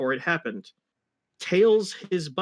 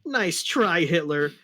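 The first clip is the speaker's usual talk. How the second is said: Sonic taunt; 3 semitones higher, 3 dB louder than usual. Acted. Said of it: "and said".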